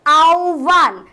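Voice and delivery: "আহ্বান" as a long aau, then bhan; slow correct pronunciation. Shown as a demonstration. Teacher recaps the answers, "আহ্বান" stretched slowly into aau-bhan. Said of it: "আমি বললাম".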